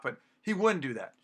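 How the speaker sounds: usual speaking voice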